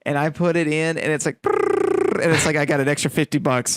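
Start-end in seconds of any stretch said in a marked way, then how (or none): 1.4-2.2 mock deflating noise. Retelling a disappointing paycheck.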